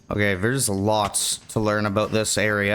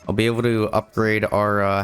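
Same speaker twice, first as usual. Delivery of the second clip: ordinary talk, nothing marked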